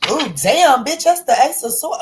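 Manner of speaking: high, loud shocked exclamation; shocked outburst. Real, not acted. Reader shocked by the card, a high loud yelp.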